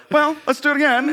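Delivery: bright high chipper retake line; perky redo. Retold or imitated. Director chirps to actor, high and chipper. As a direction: director cheerfully hiding the failed take. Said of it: "I'd be like".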